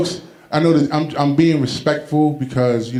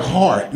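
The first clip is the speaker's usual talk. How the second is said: hard punched with a laugh; stressed hard. Shown as a demonstration. Comedian explaining he means hard as in tough, stressing hard. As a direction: lean on hard. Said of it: "I'm talking about, like".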